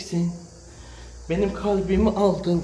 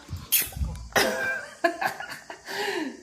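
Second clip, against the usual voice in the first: being tickled; 13 semitones higher, 9 dB quieter than usual. Real, not acted.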